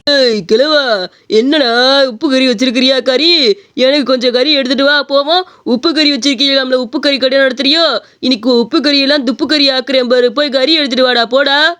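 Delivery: put-on rough drunk picking a fight, rude and taunting; drunk bully. Drunk man demanding meat from the old shopkeeper, taunting. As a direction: slur out insults at the old man.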